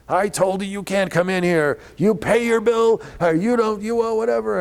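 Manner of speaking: high, loud barking at the door; yelling manager. Retold or imitated. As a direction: shout him away like an angry manager. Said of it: "are you yelling at me".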